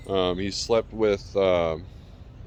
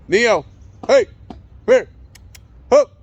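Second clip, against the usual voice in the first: much higher, much louder call across the yard; calling the dog; 13 dB louder than usual. Real, not acted. Owner calling Neo back across the yard, loud high call.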